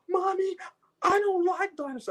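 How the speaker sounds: put-on high, whimpering little-girl whine; scared little girl. Man mimics scared girl telling her mom, high whimpering voice. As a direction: play the frightened kid near tears. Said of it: "She was like".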